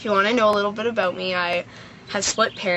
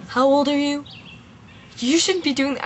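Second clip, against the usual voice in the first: shocked dad; 6 semitones higher than usual. Retold or imitated. Dad, shocked by his teenage daughter, alarmed.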